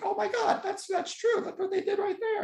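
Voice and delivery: amazed inner voice. Retold or imitated. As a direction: gush like you just spotted the trick. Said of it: "It's like".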